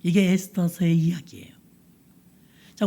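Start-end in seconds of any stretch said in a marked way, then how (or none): 0.0-1.5 hushed, breathless close; soft conclusion to the tale; whisper. Real, not acted. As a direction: whisper the ending. Closing the story.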